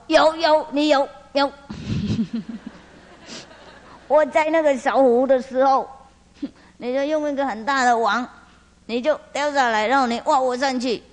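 high, insistent, half-laughing complaint; hammed-up high dragon voice; indignant dragon king. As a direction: squeal the accusation, outraged and petty. Dragon king accuses the fisherman.

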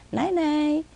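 high singsong goodnight; high, lilting birdy goodnight; parrot mimicking owner. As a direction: imitate a parrot's high night-night. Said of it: "Exactly like my voice".

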